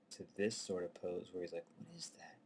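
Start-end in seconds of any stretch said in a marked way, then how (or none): 1.8-2.4 breathy hushed whisper; whispered puzzlement. Retold or imitated. Acting out puzzled character.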